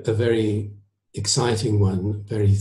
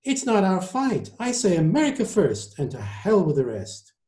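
high-starting American declaration, falling away; insistent surgeon; 9 semitones higher than usual. Acted. Surgeon rejects the fight at dinner, insistent. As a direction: insist on it as an isolationist diner.

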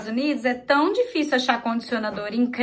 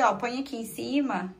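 normal talking voice

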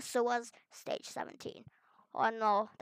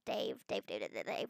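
whispered calling; 9 dB quieter than usual. Pretend. Calls name, fading to whisper.

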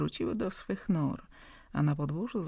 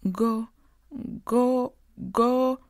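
mock stutter; 7 semitones higher, 6 dB louder, much slower than usual. A narrator's reading. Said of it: "podpowiedziała Kazia".

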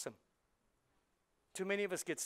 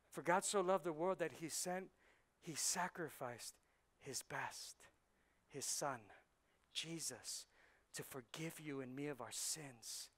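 soft earnest preaching; 5 dB quieter than usual. Acted. Pastor telling the church God gave his son, soft and sincere. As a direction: bring it down, tender and sincere.

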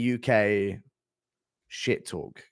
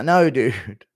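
normal talking voice

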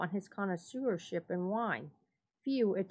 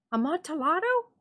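high, louder rising question; eager Fortunato; 9 semitones higher, 5 dB louder than usual. A narrator's reading. Drunk friend craving the wine, high eager question. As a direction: ask it again, hungry for it. Fortunato repeats word, amazed.

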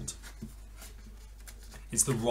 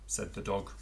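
plain reading voice

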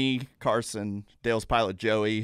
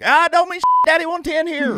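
high, loud mock Dale quote; high Dale imitation; 9 dB louder than usual. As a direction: squeak it out like excited Dale. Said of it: "needed a little more twang and a couple more octaves".

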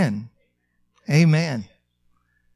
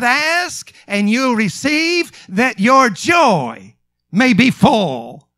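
high, slow, drawn-out proclamation; ringing sermon quotation; emphatic preaching; 5 semitones higher, much slower than usual. Real, not acted. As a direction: declare it as a promise, word by word.

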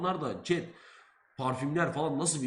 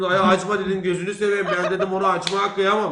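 much louder, begging him not to open it; loud pleading; 3 semitones higher, 9 dB louder than usual. Retold or imitated. Student begging the seller not to open the bottle, loud plea. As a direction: plead loudly, half laughing.

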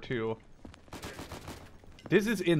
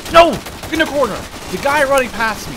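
sudden loud high yell warning a teammate; alarmed shout; 9 semitones higher, 13 dB louder than usual. Real, not acted. Player shouting a warning about an enemy in the corner, loud and alarmed. Enemy spotted at close range.

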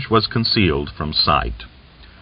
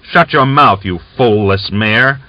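louder, higher angry shout; 6 semitones higher, 4 dB louder than usual. A narrator's reading. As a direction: bark it back at her. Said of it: "he said in a loud voice".

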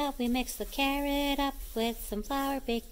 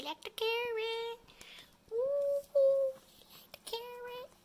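high delighted coo; 11 semitones higher than usual. Real, not acted. Delighted at dogs eating.

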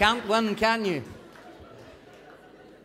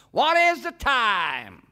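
booming slow question; big loud Yank asking; loud slow drawl; 2 semitones higher, 8 dB louder, much slower than usual. Acted. Big Yank asks loudly.

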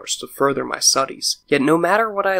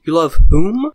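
tremulous question; 3 semitones higher, 9 dB louder than usual. A narrator's reading. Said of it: "to ask tremulously".

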